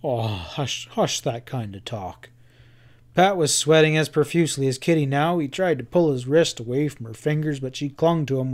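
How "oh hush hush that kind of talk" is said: low, soft and slow; calm her down gently; soothing hush. A narrator's reading. Pat soothes frightened Kitty, softly and slowly.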